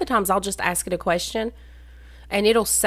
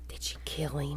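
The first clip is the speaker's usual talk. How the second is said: whispering; 4 semitones lower, 14 dB quieter than usual. Real, not acted. Gasping at story twist.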